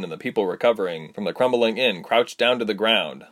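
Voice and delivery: ordinary delivery, nothing marked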